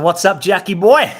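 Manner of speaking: loud, high, laughing impression of a buddy; hearty laughing bro greeting; Clayton's greeting. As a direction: boom it out like an old mate greeting you. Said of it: "That's actually how he would say it".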